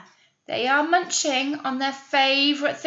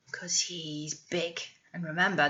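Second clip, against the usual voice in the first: whisper; 5 semitones lower, 8 dB quieter than usual. Real, not acted. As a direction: lean in and whisper the secret. Teacher whispers to children.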